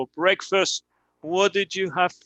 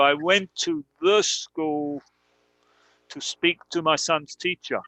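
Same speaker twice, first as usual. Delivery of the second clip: slow example sentence. Shown as a demonstration. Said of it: "Pero si estamos hablando en otro sentido".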